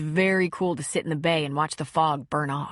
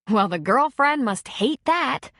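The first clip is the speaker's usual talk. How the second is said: sarcastic; 6 semitones higher, 4 dB louder than usual. Acted. Sarcastic jab at ex.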